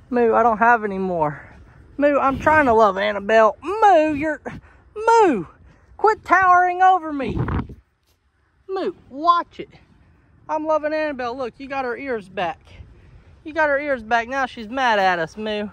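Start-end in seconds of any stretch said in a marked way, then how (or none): 3.6-9.7 high, insistent scolding; exasperated scolding. Real, not acted. Owner scolding an animal towering over them, exasperated. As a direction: raise the pitch and tell it off.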